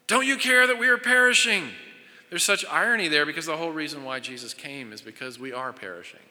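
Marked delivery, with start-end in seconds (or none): 0.0-1.7 raised, high plea of men in a sinking boat; desperate cry. Acted. Pastor voicing frightened disciples to Jesus, desperate raised plea.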